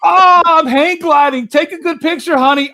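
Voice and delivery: loud, very high mocking voice; hammed-up mimicry of a hang glider, stretched and shouted; Chaz. Movie character mocking the hang gliding victim, high hammed-up imitation. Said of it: "This is Chaz talking about".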